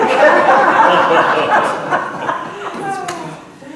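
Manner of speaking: loud high burst of laughter; big laugh. Real, not acted. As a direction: explode into laughter. Laughs at Aussie punchline.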